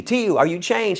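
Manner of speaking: high-pitched call to a partner; excited mock yell; retold yell. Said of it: "I yell out to T U".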